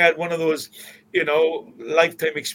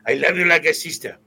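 put-on quick, louder Spanish-accented English; 4 semitones higher, 6 dB louder than usual. [name] tells Kevin he loves him in a Spanish accent. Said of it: "I'm doing a Spanish accent here".

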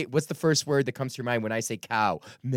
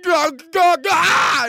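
much higher, loud mock gabble; drunk babbling yell; 9 dB louder, much slower than usual. Comic imitating a drunk heckler's babbling shout. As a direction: blurt nonsense like a sloshed heckler. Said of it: "if they're like".

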